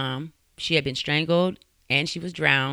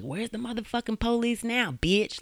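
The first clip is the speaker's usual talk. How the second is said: higher-pitched mock rant, not shouted; retold angry taunt, pitched up; retold going off; 9 semitones higher than usual. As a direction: imitate an abuser going off. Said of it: "going off".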